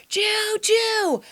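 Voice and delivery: high, drawn-out call of a name; mock yelled call. Friend on the play structure spots her, high call-out. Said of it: "she yells out".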